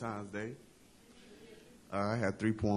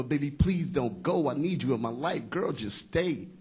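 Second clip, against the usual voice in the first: higher, drawn-out lover's plea within a poem; pleading; 7 semitones higher, 4 dB louder, slower than usual. Acted. Man begging his girl not to leave, performed pleading. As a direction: beg lightly, in the poem's rhythm.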